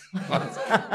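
sudden loud high laugh; real burst of laughter at a friend's joke; a real laugh that jumps up high. Real, not acted. Reacting to his friend's joke.

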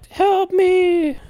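very high squeaky cry for help; tiny Fly voice. Retold or imitated. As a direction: squeak it like the little fly-man. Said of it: "talking about".

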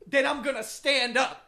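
loud, hammed-up ultimatum; escalating threat. Acting out the peak of a shut-up match, loud mock threat. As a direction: announce the mock threat loudly, step by step. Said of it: "the shut ups get higher and higher".

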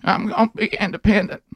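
high creepy horror-movie voice; menacing high pitched monster voice; scary movie villain. Pretend. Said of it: "this is how it talks".

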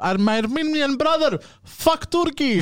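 high, shrill-voiced imitation of mother; mother impression. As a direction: squeak it up like mom yelling. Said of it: "she goes".

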